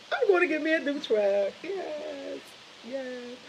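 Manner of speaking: high-pitched gleeful announcement, trailing into soft yeses; super excited. Real, not acted. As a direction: savour the good news in a high voice. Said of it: "super excited".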